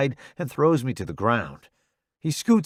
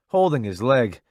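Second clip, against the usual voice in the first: usual speaking voice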